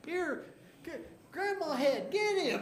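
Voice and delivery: high, loud sic-'em call; excited high command. Acted. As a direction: urge the head on, pitching up. Urging the head to attack.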